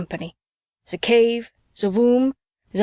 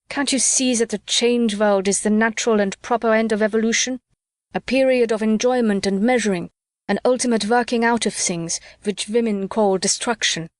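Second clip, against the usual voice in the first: plain reading voice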